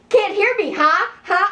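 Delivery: high, pushy mock teasing; imitating a cocky brother's taunt. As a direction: goad them for a reaction. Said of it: "he kept taunting".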